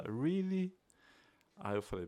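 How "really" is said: higher-pitched, soft 'really?' of interest; feigned enthusiasm. Shown as a demonstration. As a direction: play along as if keenly interested. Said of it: "o cara tá empolgado". (empolgação fingida)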